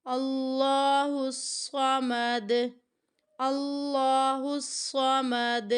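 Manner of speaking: very slow chanted قل هو اللہ with drawn vowels; Quran recitation. Shown as a demonstration. Teacher recites slowly so students read along. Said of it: "اس پہ بھی ہمیں قلقلہ کرنا ہے".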